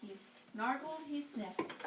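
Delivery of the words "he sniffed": usual speaking voice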